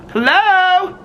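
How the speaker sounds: high, loud call across the yard; a loud, high hello to someone across the way. Real, not acted. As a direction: hail a neighbor from afar. Calling to neighbor across yard.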